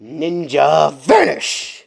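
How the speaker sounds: slow, drawn-out anime battle call; anime move shout. Acted. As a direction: call out the ninja move slowly like an anime hero. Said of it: "one move that he learned from an anime".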